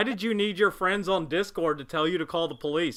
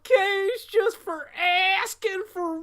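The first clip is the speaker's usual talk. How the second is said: high, slow theatrical mockery; hammy stage actor; 11 semitones higher than usual. Mocking S2's theatrical line.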